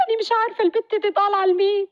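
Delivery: high, tearful lament; through tears. Acted. Jannah grieves over her dark-skinned baby, high and tearful. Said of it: "وكملت من وسط دموعها".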